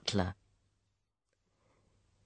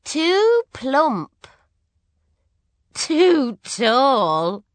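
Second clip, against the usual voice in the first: much higher, slow, loud whiny princess; bored princess; 10 semitones higher, 7 dB louder, much slower than usual. Acted. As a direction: drag it out as a spoilt princess. Prue rejecting princes.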